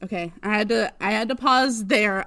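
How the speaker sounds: ordinary talk, nothing marked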